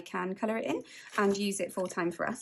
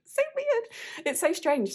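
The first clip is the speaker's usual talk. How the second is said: amazed; 9 semitones higher, 3 dB louder, slower than usual. Real, not acted. Amazed at own items on video.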